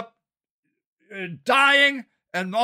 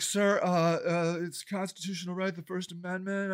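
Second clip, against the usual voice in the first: hesitant, very soft, lower voice; meek protest; 6 semitones lower, 11 dB quieter than usual. Retold or imitated. Canvasser citing rights to HOA president, meek. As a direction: stammer a timid objection under your breath.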